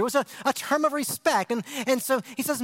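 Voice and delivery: usual speaking voice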